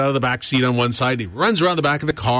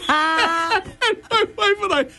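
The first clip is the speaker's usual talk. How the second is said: laughing; 10 semitones higher, slower than usual. Real, not acted. Laughing through the story.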